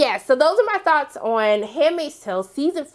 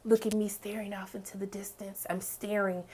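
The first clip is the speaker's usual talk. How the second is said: soft breathy whisper mocking June's stare; 3 semitones lower, 13 dB quieter than usual. Vlogger mocking June's dramatic stare, soft hushed whisper. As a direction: whisper it like a dramatic heroine gazing off. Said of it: "does that June thing".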